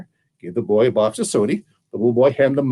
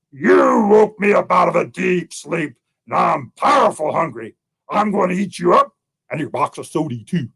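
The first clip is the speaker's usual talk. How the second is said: menacing hungry bear; 5 semitones higher than usual. Acted.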